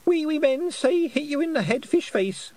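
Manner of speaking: put-on very high, gleeful toddler chant; happy small child. A narrator's reading. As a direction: pipe it up like a delighted little boy. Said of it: "said Wentworth happily".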